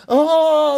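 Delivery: sharp loud gasp; shocked gasp. Acted. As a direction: gasp in horror. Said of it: "goes running off crying crazy".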